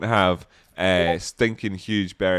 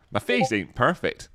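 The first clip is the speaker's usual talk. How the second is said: ordinary talk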